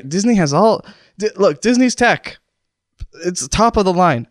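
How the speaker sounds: ordinary delivery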